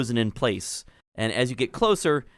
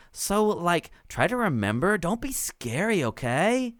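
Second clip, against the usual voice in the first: high airy gnome, every word a drawled "like"; valley girl gnome; 6 semitones higher than usual. Acted. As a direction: sound like a chill little valley girl. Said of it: "and Clotilda says".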